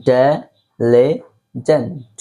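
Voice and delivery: diligent stretched out very slowly, syllable by syllable; slow model pronunciation. Shown as a demonstration. Teacher modelling a word for students, very slow. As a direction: spell out diligent slowly for the class. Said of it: "repeat after me".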